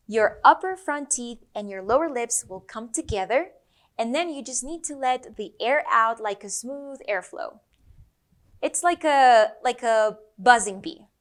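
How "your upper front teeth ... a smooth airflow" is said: usual speaking voice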